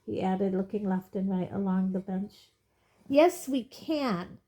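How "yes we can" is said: slow, higher, indignant reply. A narrator's reading. Old witness indignant at the minister's doubt. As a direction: bristle at the insult. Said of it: "said Missus Figg indignantly".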